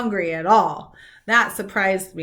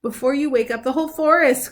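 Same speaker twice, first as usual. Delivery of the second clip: louder scolding order; angry squirrel; 5 semitones higher, 5 dB louder than usual. Acted. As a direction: shoo him home crossly. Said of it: "cried the angry squirrel".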